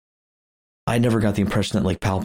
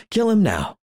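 Palpatine. Retold or imitated. Podcaster imitating the villain ordering a death, commanding. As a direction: imitate an evil master's kill order.

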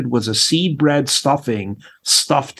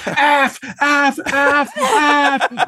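mock bleeped curse; 12 semitones higher, much slower than usual. Guest on fire cursing.

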